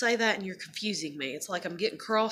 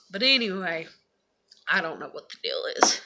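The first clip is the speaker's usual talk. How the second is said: ordinary talk, nothing marked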